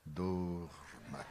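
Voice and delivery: deep, hollow hypnotist command; cavernous voice. Shown as a demonstration. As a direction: intone it low like a hypnotist. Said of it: "E fiz aquela voz cavernosa".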